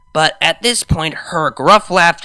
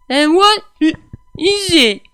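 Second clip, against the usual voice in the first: put-on high, loud, slurring drunk mare; 14 semitones higher, 5 dB louder than usual. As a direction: play her sloppy and giddy drunk.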